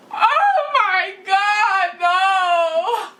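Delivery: high, slow, hammed-up crying plea; mock tearful wailing. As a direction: overact the heartbroken girlfriend begging. Retold tearful dramatic goodbye.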